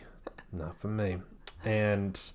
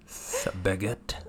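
slow, hammed-up French accent; a silly mock French snake; French snake impression; 4 dB quieter, slower than usual. Host doing a French snake for her co-host, slow mock French accent. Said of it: "my French snake impression".

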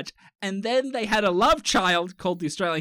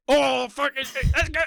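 middle-aged social player; 8 semitones higher, 4 dB louder than usual. Retold or imitated. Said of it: "middle-aged men going, like".

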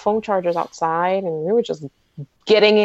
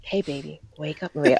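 softer, lightly voiced crooner; imitating the singer; 4 semitones lower, 6 dB quieter than usual. Fan imitating the singer waking her up, softly crooning. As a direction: croon a gentle wake-up. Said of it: "I wanna record him".

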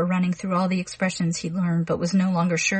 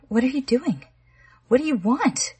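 frightened; 6 semitones higher than usual. A narrator's reading. Connie frightened by Arnold.

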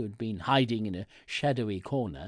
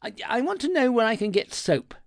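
quick, higher-pitched, louder plea; hurried request; 10 semitones higher, 6 dB louder, faster than usual. A narrator's reading. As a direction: blurt it out fast with a small stumble. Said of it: "I said quickly".

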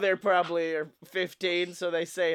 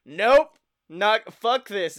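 louder, firm mock refusal; imitated abrupt refusal; 4 semitones higher, 7 dB louder, much slower than usual. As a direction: act out a rider bailing at the last second. Retold rider backing out before start.

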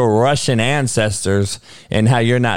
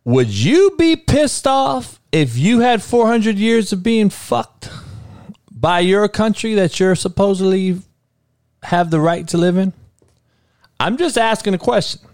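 heated; 6 semitones higher than usual. Real, not acted. Heated rant about four hundred years.

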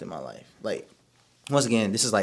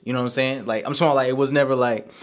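normal talking voice

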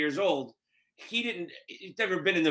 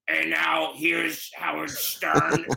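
electrolarynx announcement; much slower than usual. Retold or imitated. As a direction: announce it through a throat buzzer, dragging every word. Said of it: "So it sounded something like this".

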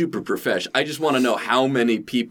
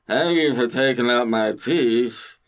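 drugged patient; slower than usual. Acted. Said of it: "someone else would be like".

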